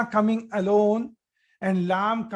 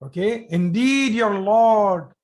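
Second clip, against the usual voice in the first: ordinary talk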